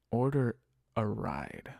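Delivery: ordinary talk, nothing marked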